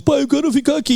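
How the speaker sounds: high-pitched imitation of a kid; pleading child. Father voices his child pleading.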